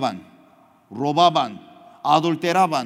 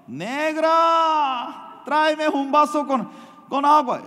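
husband hollering for water; calling out to wife; 11 semitones higher, slower than usual. Retold or imitated.

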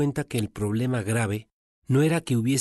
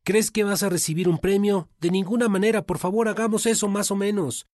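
scolding coworkers; 7 semitones higher than usual. Acted. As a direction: scoff at Giovanni for trying so hard. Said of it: "lo regañaron diciéndole".

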